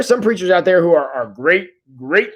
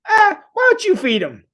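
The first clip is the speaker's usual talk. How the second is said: high, teasing squeak of a line; mock-innocent high voice; playful Jesus voice; 14 semitones higher than usual. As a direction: toss it off like a cheeky challenge. Jesus voiced in retold story.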